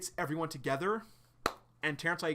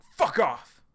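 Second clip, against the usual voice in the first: loud snap; 9 dB louder than usual. Retold or imitated. John telling attacker off.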